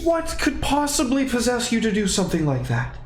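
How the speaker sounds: scolding high dragon voice; lofty dragon, scolding the party. Acted.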